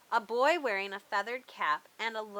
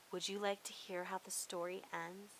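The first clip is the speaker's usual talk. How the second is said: soft, much quieter invitation; gentle; 2 semitones lower, 10 dB quieter than usual. Acted. Boy speaking softly to the dragon, gentle. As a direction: offer it gently like an outstretched hand. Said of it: "He reached out a hand".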